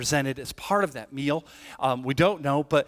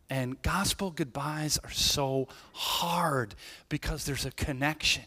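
ordinary talk

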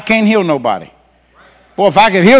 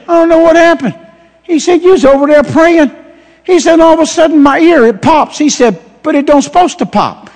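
high, raised imitation of an amazed kid; pitched-up child's account, building; little boy; 8 semitones higher than usual. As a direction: retell it as an excited young boy. Voices the little boy's amazed account.

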